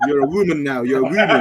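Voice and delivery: laughing tease. Real, not acted. Teasing laughing.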